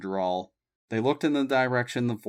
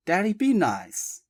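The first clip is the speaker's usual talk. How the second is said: high, lightly scolding daughter; warning; 9 semitones higher than usual. A narrator's reading. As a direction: warn him to behave. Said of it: "Abby warned".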